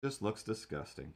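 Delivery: muttered disgust, dropped to a near hush; deadpan mutter. Acted. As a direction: mutter it under your breath in disgust. Quiet repeat of verdict.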